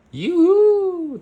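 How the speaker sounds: high, loud yo; excited exclamation. Acted. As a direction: exclaim in delight.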